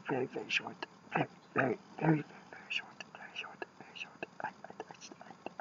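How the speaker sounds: hopeless whispered grumbling. Acted. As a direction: mutter to yourself in defeat. Despairing off-mic muttering.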